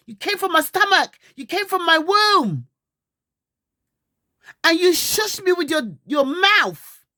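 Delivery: loud, high, furious scolding; angry shouting. Real, not acted. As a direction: yell at him in real outrage. Mother raging at her son.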